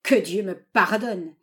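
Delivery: slow, loud indignant repeat; old man's drawn-out angry exclamation; outraged howl. A narrator's reading. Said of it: "hurla le vieillard".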